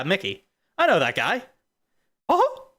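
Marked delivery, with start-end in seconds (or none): 2.3-2.7 tiny falsetto cartoon chuckle; very high mouse squeak. Streamer recalls [name], squeaky falsetto chuckle.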